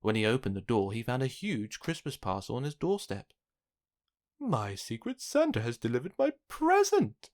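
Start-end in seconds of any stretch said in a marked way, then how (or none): 4.4-7.3 higher, louder, thrilled character voice; excited cry. Acted. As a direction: burst out with delight at the parcel. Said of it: "cried Mister Nosy".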